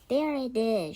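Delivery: loud spotting shout; goblin shout. Acted. Goblin spotting the kitten, loud shout. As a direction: call it out to the other goblins. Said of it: "shouted one of the goblins".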